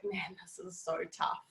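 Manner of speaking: a soft, weary whisper; hushed, defeated whisper to herself; whispered despair. Retold or imitated. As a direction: breathe it out as if worn down. Retold despair while single mum.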